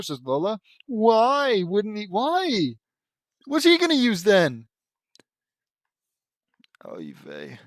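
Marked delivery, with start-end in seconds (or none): 0.9-4.7 high, loud incredulous protest; exasperated outburst at a silly story; exasperated disbelief. Real, not acted. 6.9-7.6 low, quiet deflated groan; weary resignation. Real, not acted. Deflated after the outburst.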